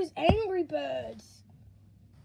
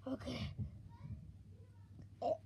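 ordinary talk

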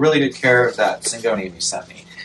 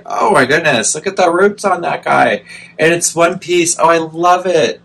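louder, higher excited gushing; gushing delight; 4 semitones higher, 5 dB louder than usual. Real, not acted. Admiring big roots on a gifted plant, gushing. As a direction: gush over a treasure, real delight.

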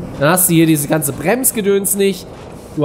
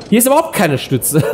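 laughing nervously on the ride; 7 semitones higher, 4 dB louder than usual. Real, not acted. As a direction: laugh through the words. Riding coaster, laughing.

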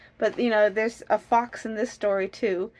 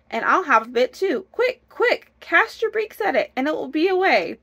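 high, excited storybook wife; shrieky-high, hurried command; urgent urging; 7 semitones higher, 5 dB louder than usual. Acted. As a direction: rush him along before it escapes.

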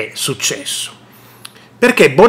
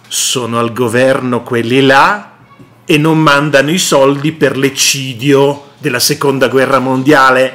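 ordinary delivery

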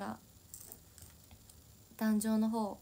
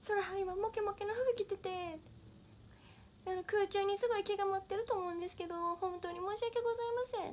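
teammate's exaggerated, formal, much higher apology; imitating earnest apology; 11 semitones higher than usual. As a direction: play the overly polite teammate apologizing. Retelling teammate's loud formal apology.

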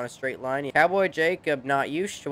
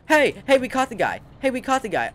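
much higher, loud triumphant hey; real gamer excitement, pitched way up; excited shout; 10 semitones higher, 5 dB louder than usual. Real, not acted. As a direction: yell it like you finally nabbed him. Catches fleeing driver in game.

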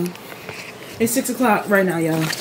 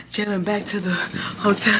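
ordinary talk

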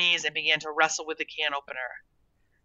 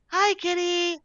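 very high, sing-song hello; coaxing greeting; 14 semitones higher, slower than usual. A narrator's reading. Girl to a hiding cat, high coaxing greeting.

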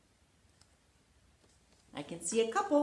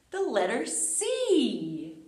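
slow, emphatic reveal of "The letter C"; announcing the letter; 5 dB louder, slower than usual. Shown as a demonstration. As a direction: stretch out "The letter C" slowly, stressing "C". Introducing the letter of the day.

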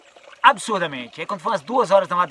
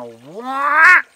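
high, loud joyful whoop; happy yell taking off on a borrowed bike; 9 semitones higher, 10 dB louder than usual. Retold or imitated. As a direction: let out a burst of joy on the bike. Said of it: "fiz assim, ó".

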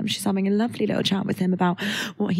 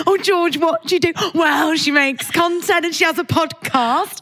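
higher, excited mimic of her mum, slow at the end; imitating her mum; 6 semitones higher than usual. Retelling her mum's chat on the beach, proud mock mum voice. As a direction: gush about your daughter like a proud mum. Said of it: "exactly how her mum speaks".

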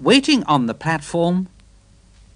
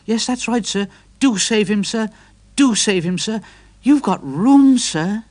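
higher, eager pleading engine; 7 semitones higher, 4 dB louder than usual. A narrator's reading. Edward begging the vicar to save Trevor, higher and eager. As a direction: beg the vicar politely, pushing harder.

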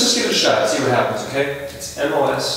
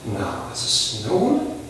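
ordinary talk

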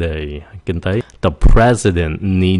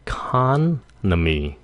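economy broken up slowly, e-CON... omy; slow teaching model of economy, stressed CON; second-syllable stress; 3 semitones higher, 4 dB quieter, slower than usual. Shown as a demonstration. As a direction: lean on CON in "economy", then pause. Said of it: "nhấn âm".

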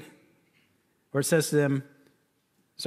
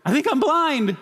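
high, louder alarmed cry; imitating stunned wife; 10 semitones higher, 5 dB louder than usual. Wife dazzled by the lightning, alarmed high cry. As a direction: voice the wife's shocked panic. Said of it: "then I hear her go".

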